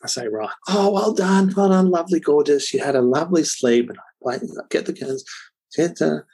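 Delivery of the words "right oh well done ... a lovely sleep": high, sing-out praise in a put-on cheery voice; imitating a parent's happy wake-up welcome; nice, happy greeting. Dad greeting baby after a sleep, putting on happy cheer. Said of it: "come in with a nice, happy approach".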